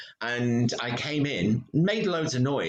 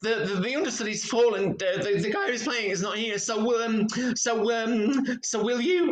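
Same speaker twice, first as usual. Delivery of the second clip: panicked; 11 semitones higher than usual. Retold or imitated. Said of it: "came running up to me going".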